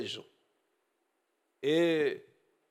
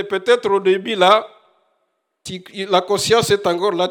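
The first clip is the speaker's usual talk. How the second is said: full-force preaching; 5 semitones higher, 8 dB louder than usual. Acted. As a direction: hammer the warning home to the congregation. (prédication ardente, plus forte et plus aiguë)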